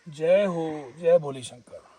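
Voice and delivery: loud call of praise; devotional call. Real, not acted. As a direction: call out to Shiva, full voice. Devotional call before eating. (ज़ोर से जयकारा)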